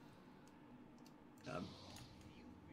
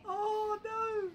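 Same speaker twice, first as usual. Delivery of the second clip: mock dismay; 5 dB louder than usual. Real, not acted.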